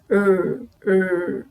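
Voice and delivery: R with the tongue rolled back; R with the tongue tip near the roof of the mouth; curled-back R. Shown as a demonstration. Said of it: "enrola a língua".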